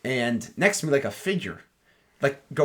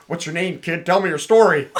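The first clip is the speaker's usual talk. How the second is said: higher, louder hammed-up newsman voice; pushy reporter; 5 semitones higher, 7 dB louder than usual. Mocking a reporter grilling a kid, hammed-up newsman voice. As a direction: play a brash old-time reporter, half laughing. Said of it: "he didn't say it like that".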